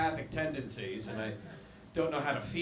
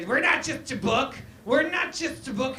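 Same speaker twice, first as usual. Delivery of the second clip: mock indignant yell; 8 semitones higher than usual. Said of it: "She's ye-, yelling".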